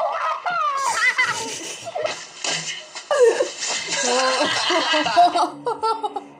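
long high laughter. Real, not acted. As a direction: laugh helplessly. Laughing at the video.

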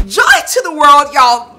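loud, high burst of holiday cheer; festive exclamation. Real, not acted. Host toasting her viewers with a loud, festive exclamation. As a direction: belt it out like a carol.

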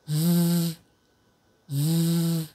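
ズー as a sustained z buzz; voiced fricative. Shown as a demonstration. Teacher drills number two for repetition, ズー as a long voiced buzz. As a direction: hold ズー as a buzzing consonant on a level note. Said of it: "二つ目の黒い方は有声音で".

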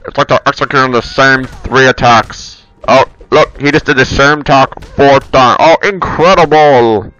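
loud, close-up mock fight commentary; booming play-by-play, badly faked; terrible commentator. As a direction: call the fight like a hammy amateur commentator. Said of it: "I'm a terrible commentator".

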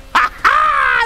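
very high, loud laugh; delighted cackle. Real, not acted. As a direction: burst into a squealing cackle. Delighted at seeing his car.

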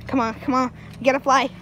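usual speaking voice

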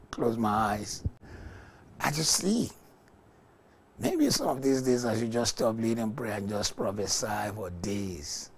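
ordinary talk

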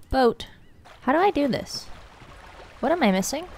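usual speaking voice

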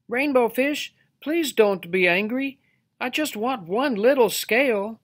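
high-pitched timid request; pleading little fish. A narrator's reading. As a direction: beg sweetly in a high small-creature voice.

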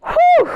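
big high exhale, whew; loud whew of relief. Acted. Teacher to kids after the dance: loud relieved whew. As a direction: blow out like you're worn out from dancing.

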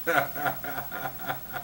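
quiet real chuckle; trailing laugh at the joke; soft laugh. Real, not acted. Host chuckling at his own joke.

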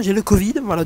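ordinary delivery

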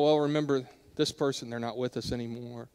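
soft, low, wistful quote; hushed memory of the lost; quiet reminiscing. Retold or imitated. People reminiscing at holidays, quoted.

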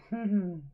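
short, high chuckle. Real, not acted. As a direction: laugh briefly at your own slip. Laughs at picking wrong person.

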